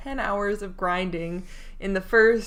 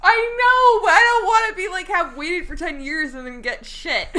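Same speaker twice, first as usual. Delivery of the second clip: laughing; 9 semitones higher, 6 dB louder than usual. Real, not acted. Laughing while arguing, high pitch.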